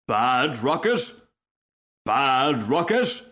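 slow, drawn-out disbelief with rising end; incredulous question. Acted. Rocket thrown into the ditch, incredulous. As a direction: repeat it slowly as a puzzled question.

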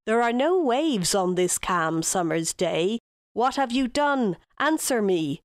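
ordinary delivery

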